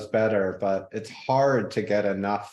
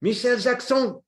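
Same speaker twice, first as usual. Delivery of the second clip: put-on French accent; 13 semitones higher, 4 dB louder than usual. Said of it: "a Frenchman, [name]".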